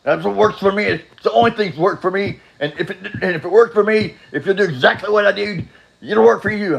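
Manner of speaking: high-pitched mocking imitation of a pushy believer; pushy mock advocate. Meeting-goer urging his own cure on him, mock-pushy. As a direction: push the advice in a high, insistent mock voice.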